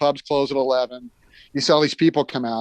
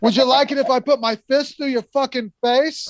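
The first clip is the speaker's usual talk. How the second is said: put-on higher, sneering drunk-bloke voice; mock hostile geezer; 9 semitones higher than usual. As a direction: spit it out like a pub thug picking a fight. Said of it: "He's like".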